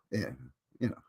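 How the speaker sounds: ordinary talk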